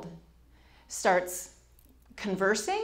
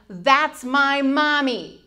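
put-on insistent toddler; 5 semitones higher, 7 dB louder, much slower than usual. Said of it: "He's saying".

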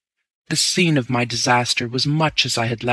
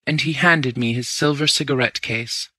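usual speaking voice

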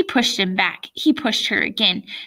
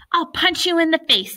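high-pitched heated threat; worked-up kid's threat; angry threat; 8 semitones higher, 3 dB louder than usual. A narrator's reading. Boy threatens girl in fight.

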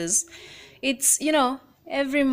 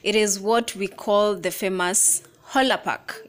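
ordinary talk

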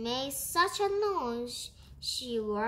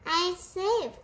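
high, louder cry of relief; relieved exclamation; 7 semitones higher, 5 dB louder than usual. A narrator's reading. Rat safe inside the hole, relieved exclamation. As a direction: pipe up in happy relief.

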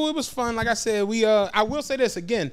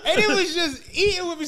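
laughing; 7 semitones higher than usual. Real, not acted. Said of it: "laughs, retelling shame".

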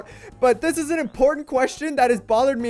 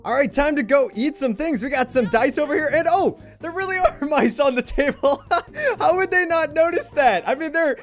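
normal talking voice

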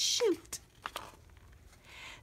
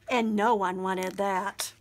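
ordinary delivery, nothing marked

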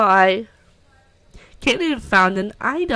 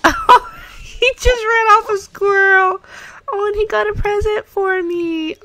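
high, loud delighted chatter; excited pet talk; 10 semitones higher, 6 dB louder than usual. Real, not acted. Player delights in her dog's antics, high voice. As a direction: beam about the dog. Talking about pet dog.

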